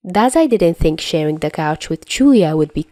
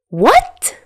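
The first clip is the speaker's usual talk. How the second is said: high, louder shocked what; sputtering; 11 semitones higher, 5 dB louder than usual. Acted. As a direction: blurt it in disbelief. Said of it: "Dazai sputters".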